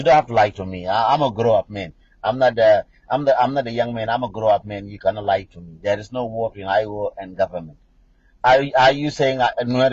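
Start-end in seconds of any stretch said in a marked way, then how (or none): none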